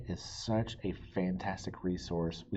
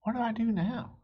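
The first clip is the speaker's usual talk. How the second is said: put-on higher, curious kid's voice; little boy Pete. Pete asking the librarian what to do, high kid voice. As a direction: ask it wide-eyed like a small boy. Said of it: "Pete asks".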